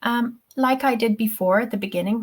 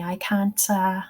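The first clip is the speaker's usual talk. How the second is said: usual speaking voice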